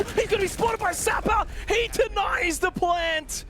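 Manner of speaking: high, loud yell on the denial; excited shout. Real, not acted. Caster shouting to viewers as Sapper denies the plant. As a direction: scream the call as Sapper wins it.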